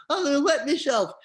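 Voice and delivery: high, loud mock panic; desperate warning. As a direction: imitate a friend bursting for the toilet. Friend desperate, laughing, about to drop.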